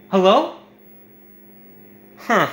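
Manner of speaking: ordinary delivery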